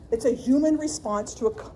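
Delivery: ordinary talk, nothing marked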